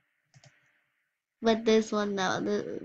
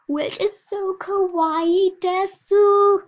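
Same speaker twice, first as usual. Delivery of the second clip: high, slow squealing gush; excited anime fan; 8 semitones higher, 9 dB louder, slower than usual. Pretend.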